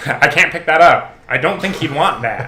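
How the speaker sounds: high, loud goofy character; cartoon voice. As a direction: bounce it like a cartoon. Imitating game sound bits.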